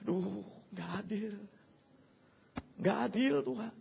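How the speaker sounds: whiny soft crying complaint; half-hearted crying to God; mock crying. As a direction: whimper it through mock tears. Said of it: "Sambil nangis".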